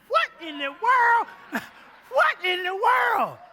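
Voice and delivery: loud, high, slow exclamation; shocked outcry. Retold or imitated. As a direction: cry out in disbelief. Retold shock at GPS time.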